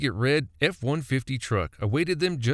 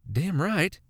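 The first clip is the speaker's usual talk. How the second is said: spewing; 6 semitones higher than usual. A narrator's reading. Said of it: "she spewed".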